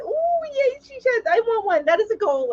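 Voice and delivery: high squealing delight; gushing over a friend's she shed; excited squeal. Real, not acted. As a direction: squeal with envy at the news. Thrilled by viewer's she shed.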